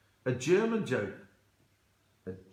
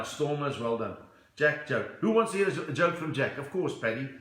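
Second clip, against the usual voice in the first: ordinary talk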